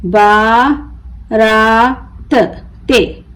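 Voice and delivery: बारात very slow in three pieces, बा, रा, त; broken into syllables. Shown as a demonstration. Teacher breaking the word for learners, बारात as baa... raa... ta. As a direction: stretch and separate each part of बारात. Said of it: "तोड़ तोड़ कर पढ़ना सीखें".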